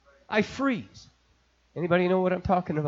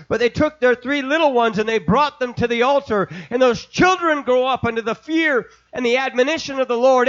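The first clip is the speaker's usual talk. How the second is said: raised, loud, driving preacher voice; preaching at full force; 5 semitones higher, 4 dB louder than usual. Acted. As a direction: preach it high and loud to the congregation.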